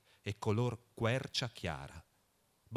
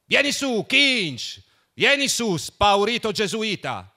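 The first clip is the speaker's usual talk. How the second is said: high, loud shout down the stairwell; shrill summons, calling someone up; shrieking call; 10 semitones higher, 12 dB louder, slower than usual. A narrator's reading. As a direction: yell down the stairs at him. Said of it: "strillò".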